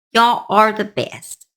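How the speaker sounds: normal talking voice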